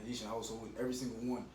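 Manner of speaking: ordinary talk, nothing marked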